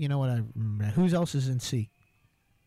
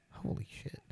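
whispered; 13 dB quieter than usual. Real, not acted. Shocked reaction to a bad score.

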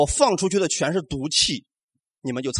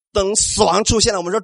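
normal talking voice

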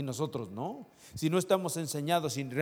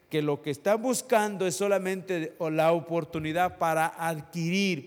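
raised, higher preaching voice, slowing to press each phrase; preaching at full force; 4 semitones higher, 5 dB louder than usual. Acted. Pastor urging the congregation, preaching at full force. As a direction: preach it out to the whole church.

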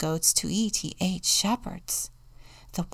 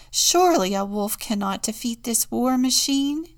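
loud, higher confident exclamation; crying out; 5 semitones higher, 6 dB louder than usual. A narrator's reading. Contessa confident in the war machine, crying out. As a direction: declare it with confidence. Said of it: "cried the Contessa".

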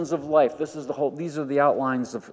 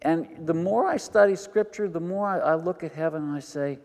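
usual speaking voice

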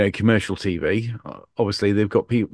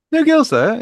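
much higher, louder laughing repeat; amused shout; 9 dB louder than usual. Real, not acted. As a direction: squeal the punchline in delight.

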